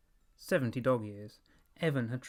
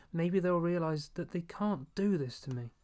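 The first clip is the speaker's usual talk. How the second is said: put-on higher, pleading boy's inner voice; boy's anxious thought; 6 semitones higher than usual. A narrator's reading. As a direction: let the boy's worry rise up.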